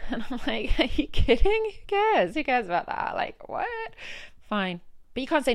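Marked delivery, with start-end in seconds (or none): 0.8-3.7 high and loud who cares; retold exasperated outburst; outraged disbelief. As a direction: scoff at the request. Asked to stay for digital.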